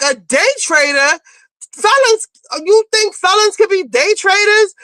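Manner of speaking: high-pitched mock disbelief; incredulous. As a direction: throw it back in scornful astonishment. Acts out Evelyn's incredulous comeback.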